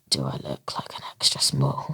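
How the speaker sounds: low, hushed, disbelieving whisper; offered an extra small T-shirt, an indignant whisper. Retold or imitated. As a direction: whisper it with offended sarcasm. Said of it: "And I just went".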